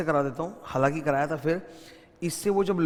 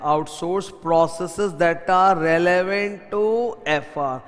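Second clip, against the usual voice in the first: drawn-out, very slow key phrase, slightly louder; slow dictation; 4 dB louder, much slower than usual. Shown as a demonstration. Teacher dictating the key definition to students, very slow. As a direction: lay down the definition slowly.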